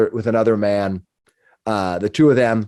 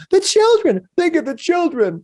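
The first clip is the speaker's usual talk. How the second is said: shrieking much higher, frantic; hysterical outcry. Acted. Villager shrieking to the crowd about their children, hysterical. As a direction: wail it like a panicked townsfolk preacher. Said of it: "Let's not go crazy here".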